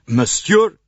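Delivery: indignant shout, high and loud; angry cry. A narrator's reading. Count protesting the accusation, angry cry. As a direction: cry out in outrage. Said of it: "cried the Count angrily".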